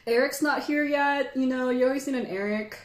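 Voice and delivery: normal talking voice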